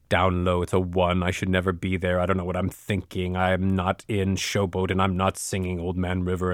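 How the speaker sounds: deep and down at the bottom of the range; very low voice. Shown as a demonstration. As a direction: speak way down low, like a one on the scale. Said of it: "my demonstration for pitch".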